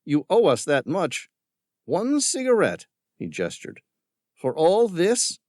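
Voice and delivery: mocking villain. A narrator's reading. Villain scorning Tom's concern for the creatures. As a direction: sneer at the tree hugger.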